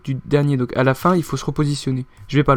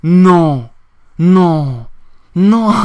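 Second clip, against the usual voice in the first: despairing no; 5 dB louder, much slower than usual. Real, not acted. Échec, répète non.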